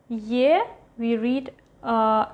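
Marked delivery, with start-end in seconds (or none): none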